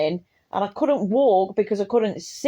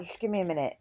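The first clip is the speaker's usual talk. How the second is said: soft, spent from laughing; out of breath; 2 semitones lower, 9 dB quieter, much faster than usual. Retold or imitated. Gasping to mum after a laughing fit, soft and winded. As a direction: barely get it out, winded.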